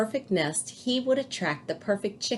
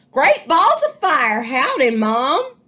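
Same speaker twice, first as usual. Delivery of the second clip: high, loud cowboy-ish hollering; a brash baby goose; 7 semitones higher, 7 dB louder than usual. A narrator's reading. Baby goose greets Jack, high and loud. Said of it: "and said".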